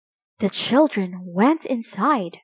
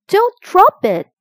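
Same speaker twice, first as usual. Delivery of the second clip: high, loud warning call; 10 semitones higher, 8 dB louder than usual. A narrator's reading. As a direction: call out a warning to the crane driver. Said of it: "called Chip".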